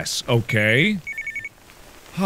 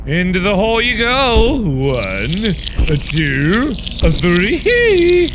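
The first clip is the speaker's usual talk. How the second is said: gleeful countdown; 5 semitones higher, 4 dB louder than usual. Acted. Counting throw into hole.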